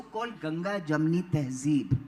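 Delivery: ordinary talk, nothing marked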